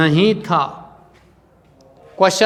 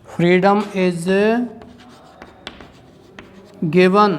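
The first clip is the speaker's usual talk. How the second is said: a few words at a time, long pauses; slow, broken-up phrases for copying; dictation; 4 dB quieter than usual. Shown as a demonstration. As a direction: dictate slowly so they can write it. लिखिए इसका answer.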